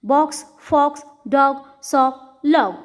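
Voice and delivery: short O in "Box, fox, dog, sock, log"; short vowel demo. Shown as a demonstration. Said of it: "it has a short sound in these words". ("Box, fox, dog, sock, log" में छोटा ओ)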